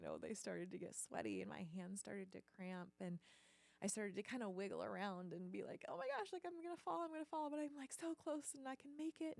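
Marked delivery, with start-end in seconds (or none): 5.9-9.4 high-pitched fretting, easing slightly as it goes; worried rush of self-talk, pitched way up; panicked little kid. Retold or imitated. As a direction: relive a child's fear of falling. Acting out her panic on monkey bars.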